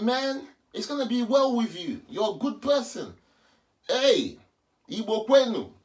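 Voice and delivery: loud, high calling blessings. Real, not acted. Calling blessings after the stranger walking away. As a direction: shout your thanks after him.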